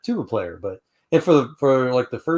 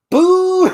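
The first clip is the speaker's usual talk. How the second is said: very high loud tooting honk; imitated high tuba note; 10 dB louder than usual. Imitating his tuba's high note, loud high honk. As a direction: toot it like a squeaky tuba.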